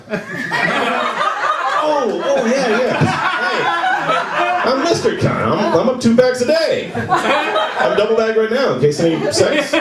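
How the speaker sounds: high, hammed-up bragging; mock-proud high voice; eager boasting. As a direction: brag in a high, laughing rush of realization. Acting out himself catching on, boasting.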